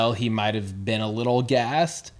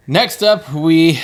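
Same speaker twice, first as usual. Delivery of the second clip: announcer voice; 4 semitones higher, 7 dB louder, slower than usual. Acted. Announcing next match.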